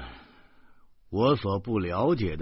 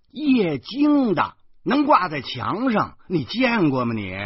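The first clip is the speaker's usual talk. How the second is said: much higher and louder, bragging and wheedling; eager boasting; 11 semitones higher, 5 dB louder than usual. Acted. Guardian bragging about his home to lure the girl, eager. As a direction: show off to win her over.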